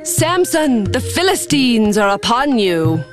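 high-pitched call to rouse him. Acted. Delilah wakes Samson as the Philistines come, calling out high. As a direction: call out high, starting on a very high name. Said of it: "Then she called".

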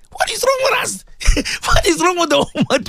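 high incredulous cry breaking into laughter; real exasperation, half laughing; exasperated laugh. Real, not acted. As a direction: laugh in high-pitched disbelief.

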